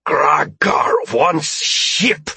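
slow, high, forceful alien shout; drawn-out alien demand; alien's shout. A narrator's reading. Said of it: "The Altari shouted".

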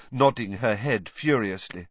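plain reading voice

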